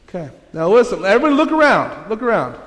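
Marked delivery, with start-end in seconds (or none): none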